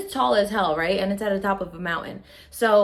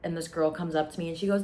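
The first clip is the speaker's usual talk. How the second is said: ordinary delivery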